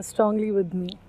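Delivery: normal talking voice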